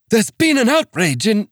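high, urgent cry cut off; frantic missionary. Acted. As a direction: rush to report before being interrupted.

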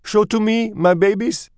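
put-on very high, loud French-accented voice; French mother mouse. A narrator's reading. Mother mouse demands to see her newborns, high and imperious. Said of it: "She was a French mouse".

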